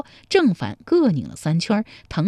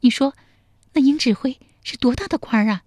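high-pitched heroine's question; high, pert questioning; inquisitive young woman; 6 semitones higher, faster than usual. Acted. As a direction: ask pointedly in a high female voice. Woman character asks.

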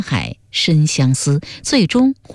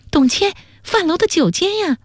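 put-on high, excited girl's voice; very high, eager explaining; overjoyed. A narrator's reading. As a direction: blurt it out in delighted excitement. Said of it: "他过于惊喜".

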